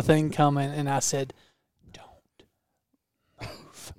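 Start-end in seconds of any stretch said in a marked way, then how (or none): 1.9-3.9 hushed, urgent warning; whisper yell. Retold or imitated. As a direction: hiss it urgently without a sound. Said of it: "was it whisper yell".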